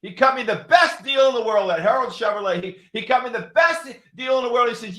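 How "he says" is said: normal talking voice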